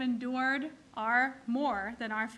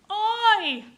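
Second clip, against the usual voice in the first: loud oi; 9 semitones higher, 10 dB louder than usual. Retold or imitated. Second woman answering the sigh.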